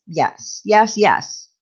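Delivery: ordinary delivery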